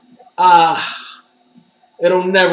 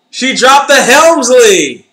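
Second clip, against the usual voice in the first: higher, louder, slow emphatic repeat; louder slower "She dropped the Helmsley" with a rise in pitch; excited loud repeat; 7 semitones higher, 8 dB louder, slower than usual. Real, not acted. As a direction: stretch it out, thrilled. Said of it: "repeat louder, slower".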